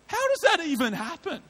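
high, incredulous wonder; amazed question. Retold or imitated. Preacher marvelling at the hitchhiker's conversion, high and amazed.

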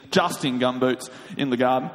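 plain reading voice

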